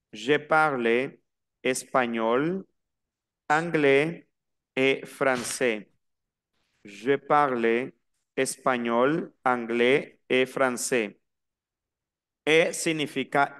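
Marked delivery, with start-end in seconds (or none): 0.1-11.2 slow phrase by phrase, anglais and français without S; silent final S. Shown as a demonstration. Teacher models the full sentence for repetition, slow, anglais and français with silent S. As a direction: pause between chunks, ending français on E. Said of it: "la S no la pronuncio".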